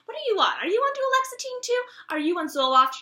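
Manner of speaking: loud, high rapid-fire questions; eager prying. Acted. Oversharer quizzing schoolmates about their medication, eagerly high and loud. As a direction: pounce on them with nosy enthusiasm.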